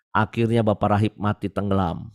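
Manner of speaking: ordinary delivery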